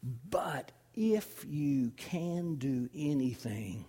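slow breathless whisper. Acted. A father whispering his plea to Jesus. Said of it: "all the man can get out of his mouth is this".

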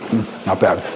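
talking while chewing; words round a bite of apple; mouth full. Real, not acted. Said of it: "I'm gonna take a little bite".

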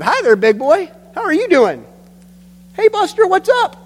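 high, loud goofy greeting; playful baby talk. Acted. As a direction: call out to a baby across the restaurant.

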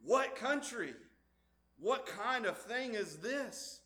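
a stranger's goods amaze you; curious astonishment. Retold or imitated. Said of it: "And they would say".

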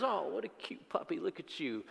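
soft high cooing at a puppy; baby-talk the cute dog; cooing receptionist. Acted. Receptionist cooing over a puppy, soft and high.

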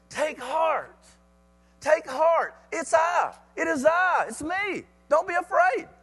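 high, urgent call to the frightened; calling out reassurance. Acted. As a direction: call out across the water to steady them. Jesus calls to frightened disciples.

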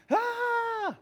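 short high mock child scream; toddler scream. Pastor imitating a toddler's nightmare scream, high and short. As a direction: shriek like a two-year-old waking from a nightmare.

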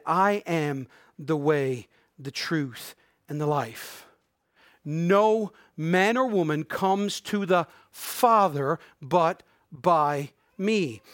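very slow scripture quote, broken into short phrases; slow and purposeful. Shown as a demonstration. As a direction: pause after each phrase, drawing out the key words. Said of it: "more slowly and more purposefully".

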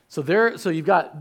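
normal talking voice

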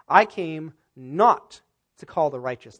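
"not" hit hard and drawn out; slow down and lean heavily on "not"; stress on NOT. Shown as a demonstration. Preacher quoting Jesus to the congregation, stressing "not". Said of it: "which gives it the emphasis".

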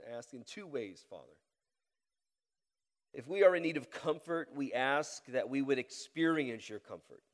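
earnest prayer with long pauses, swelling loud on the pleas; public prayer. Real, not acted. Pastor asking the Lord to comfort and challenge his church, earnest prayer. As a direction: plead with God in measured, rising phrases.

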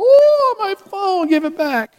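hammed-up high wail over a lost phone; mock wailing. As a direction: wail like a teen robbed of a phone. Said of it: "like wailing at the wailing wall".

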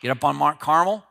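ordinary delivery

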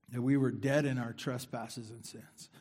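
ordinary talk, nothing marked

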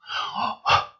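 scandalized wordless gasp; mock gasp. Congregant appalled by the pastor's wife, mock shocked gasp.

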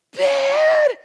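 high, loud cry for Dad; imitated child's panicked shout; fearful yell. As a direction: yell out like a lost, frightened child. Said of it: "you hear this yell, crying, fearful".

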